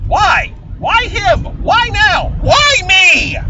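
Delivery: complaining of a baffling cameo, rising outburst; exasperated outcry. Real, not acted.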